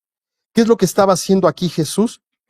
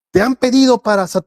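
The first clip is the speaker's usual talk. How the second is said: higher-pitched mock warning, cut short; abrupt mock announcement, raised pitch; wrong, unloving tone; 7 semitones higher than usual. Shown as a demonstration. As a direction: launch straight into alarming news. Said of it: "No comienza Jesús diciéndole".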